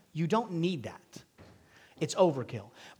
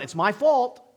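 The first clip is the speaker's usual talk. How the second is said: taking the blame; 7 semitones higher, 6 dB louder than usual. Pretend. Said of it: "The first Adam should have done".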